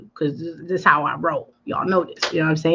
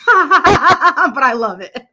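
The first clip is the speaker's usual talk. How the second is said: laughing through the words, higher and slowed; 6 semitones higher, 5 dB louder, much slower than usual. Real, not acted. Laughing at a viewer's name, laughing. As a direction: giggle it out.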